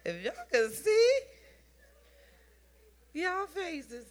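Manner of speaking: high, loud playful teasing; laughing tease. Acted. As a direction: poke fun at their faces.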